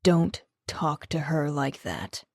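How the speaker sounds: a low warning with a threat underneath; deep and threatening. A narrator's reading. Rafe warns Connor off her, low and menacing. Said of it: "His voice was deeper than usual and carried the hint of a threat".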